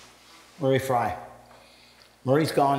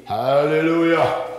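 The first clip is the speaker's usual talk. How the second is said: slow, drawn-out mock hallelujah; imitating a Pentecostal; 3 dB louder, slower than usual. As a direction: stretch it like a street preacher. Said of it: "But he'd be".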